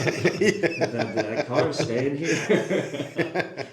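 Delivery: loud, high words through real laughter; laughing. Real, not acted. Friend joking about leaving the car home, laughing. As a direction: drag it out slowly while cracking up.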